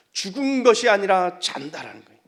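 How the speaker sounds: emphatic, full-voiced sermon line; loud proclamation. Real, not acted. Preacher proclaims Jesus's words to congregation, loud proclamation. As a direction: declare it firmly and loudly.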